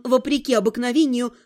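ordinary delivery, nothing marked

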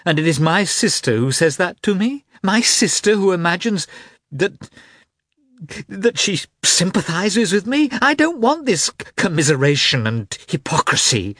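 furious wounded tirade; angry outburst. Acted. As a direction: rail at her sister, voice climbing. Kitty's angry outburst at sister.

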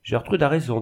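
plain reading voice

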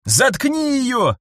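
high, slow, desperate yell; shouting. A narrator's reading. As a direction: cry it out in panic. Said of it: "крикнул Фокс".

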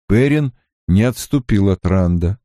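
ordinary delivery, nothing marked

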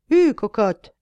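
loud yell urging the horse on; shouting coachman. Acted. Coachman yells loudly at his horse to start. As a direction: bellow at the horse like a rough coachman. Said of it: "hurle le cocher".

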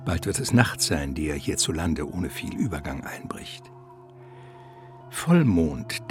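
ordinary delivery

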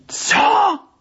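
high shocked shout; shocked cry. Acted. Deputy hearing shocking news on the phone, high cry. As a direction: cry out in disbelief.

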